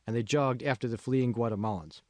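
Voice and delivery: ordinary delivery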